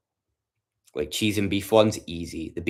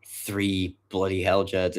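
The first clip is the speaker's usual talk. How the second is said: ordinary talk, nothing marked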